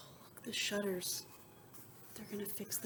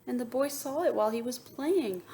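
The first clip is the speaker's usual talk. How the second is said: plain reading voice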